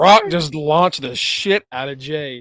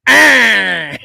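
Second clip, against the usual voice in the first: mock scream; 6 semitones higher, 9 dB louder than usual. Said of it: "he goes".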